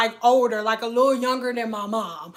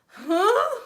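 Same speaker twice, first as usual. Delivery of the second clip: shocked huh; 8 semitones higher than usual. Retold or imitated. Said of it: "We was all like".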